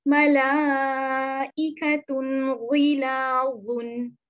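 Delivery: मलाइकतुन गिलाजुन very slow in a chanting voice, the न clear and short; izhar recitation. Shown as a demonstration. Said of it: "हम कैसे पढ़ेंगे?".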